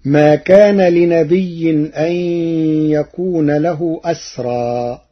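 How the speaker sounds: loud chanted verse; Quranic recitation. Shown as a demonstration. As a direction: intone it fully. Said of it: "verse recitation".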